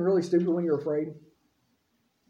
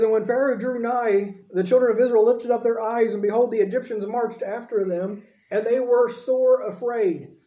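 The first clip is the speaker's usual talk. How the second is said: raised, measured Bible reading; scripture reading; 3 semitones higher, 3 dB louder than usual. Acted. Preacher reading Exodus aloud to his church, raised and measured. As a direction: read the verse out to the congregation.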